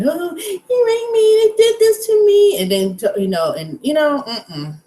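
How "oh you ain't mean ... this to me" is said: high, slow whimpering mockery; mock crying victim. Sneering at women who cry, slow high whimper. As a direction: whine it out like a fake victim. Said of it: "So you're gonna see me crying".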